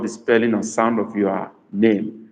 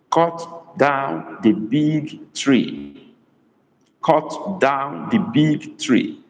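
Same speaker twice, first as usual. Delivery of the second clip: very slow, drawn-out, slightly raised pitch; slow dictation; 3 semitones higher, much slower than usual. Shown as a demonstration. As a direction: dictate it word by word for learners. Repeats the sentence to translate.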